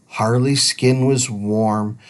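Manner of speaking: ordinary delivery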